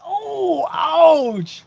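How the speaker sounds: high, drawn-out wince; long, high-pitched ouch; pained reaction. Real, not acted. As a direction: wail it like you just took a jab. Reacting to a jab in chat.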